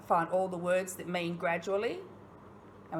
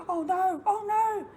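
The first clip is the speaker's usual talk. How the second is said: mock fright; 14 semitones higher, 3 dB louder than usual.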